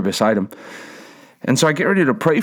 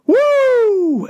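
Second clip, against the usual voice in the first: high, loud falsetto whoop; joyful whoop; 3 dB louder than usual. Retold or imitated. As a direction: whoop like a man just healed. Healed man shouts joyfully.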